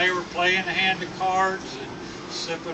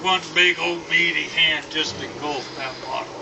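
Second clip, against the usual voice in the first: ordinary delivery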